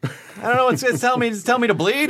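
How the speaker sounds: high-pitched mock bewilderment; baffled driver. Panicked driver puzzling over a red light, mock bewilderment. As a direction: play a flustered, stammering driver up high.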